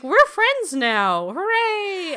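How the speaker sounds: slow, sarcastic cheery friends line; mock cheer. Dragosha suddenly befriending the girl, mock happy and slow. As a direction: overdo the fake joy. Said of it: "Dragosha's like".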